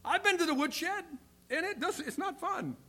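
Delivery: high-pitched mock complaint; playful rueful confession. Older believer recalling trips to the woodshed, playfully rueful. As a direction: lift the voice high as if wincing at the memory.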